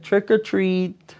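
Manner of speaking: high, bright trick-or-treat call; excited kids. A narrator's reading. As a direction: sing it out like eager kids. Kids chant at doorbell.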